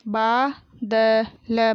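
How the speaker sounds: बादल drawn out as baa, da, la; very slow बादल, each letter held apart; spelled out. Shown as a demonstration. Teacher sounding out बादल for pupils, syllable by syllable. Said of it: "मैंने इस तरीके से पढ़ा".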